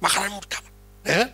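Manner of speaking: retold friend's aside. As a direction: drop the volume after the shouting. Friend's kind message after shouting. (pesan teman, pelan)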